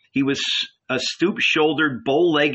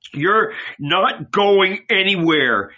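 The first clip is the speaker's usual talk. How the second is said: slow, louder, higher protest; yelled; 7 semitones higher, 4 dB louder, slower than usual. A narrator's reading. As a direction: Andrew refuses to let him go, forceful and drawn out. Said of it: "Andrew yelled".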